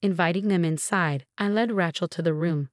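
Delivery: usual speaking voice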